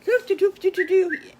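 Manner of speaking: loud high sung doops for whistling; whistling tune. Acted. The little bad wolf whistles down the road, loud and high. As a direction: belt out the wolf's whistle as doops. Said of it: "He was whistling".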